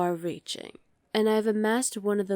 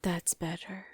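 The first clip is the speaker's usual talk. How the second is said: soft whisper; 8 dB quieter than usual. Acted. Villain purrs approval to servant.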